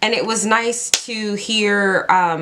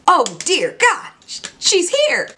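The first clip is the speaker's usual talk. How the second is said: shocked gasp; 9 semitones higher than usual. Retold or imitated. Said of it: "and I thought".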